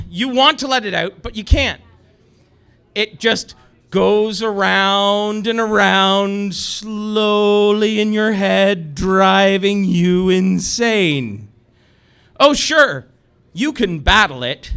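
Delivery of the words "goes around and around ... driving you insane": slow, drawn-out words on a held pitch; slow torment. Acted. Comic telling the crowd of an earworm, slowly drawn out. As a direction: stretch the words out as they circle.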